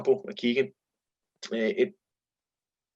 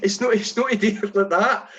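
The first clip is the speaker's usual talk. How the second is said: exasperated explaining; 8 semitones higher, 3 dB louder than usual. Retold or imitated. Explaining to teammate in disbelief.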